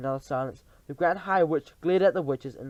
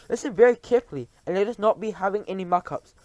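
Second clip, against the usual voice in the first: fast, loud, high stern order; Grand High Witch; 6 semitones higher, 7 dB louder than usual. Acted. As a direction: warn them sternly.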